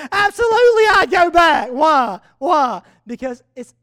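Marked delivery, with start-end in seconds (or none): 0.1-1.7 higher and louder, eager; emphatic yes. Retold or imitated. Dad telling his son he'd go back, eager. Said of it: "He says". 1.7-2.8 loud rhetorical why; preaching call. Real, not acted. Preacher pressing the congregation, loudly.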